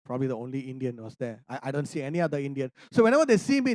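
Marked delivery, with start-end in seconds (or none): none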